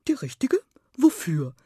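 baffled hedgehog asking; puzzled. A narrator's reading. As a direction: ask it, taken aback. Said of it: "fragte der Igel verdutzt". (verdutzt nachgefragt)

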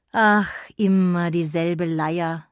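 mock-exasperated old lady; sighing old woman. A narrator's reading. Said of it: "seufzte die alte Stimme".

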